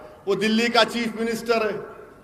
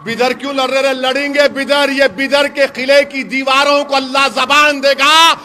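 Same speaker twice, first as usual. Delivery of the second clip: raised, driving orator's voice from a stage; fiery rally speech; 4 semitones higher, 8 dB louder than usual. Acted. Politician rousing a rally crowd, fiery full-force oratory. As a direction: hammer each line home to the crowd.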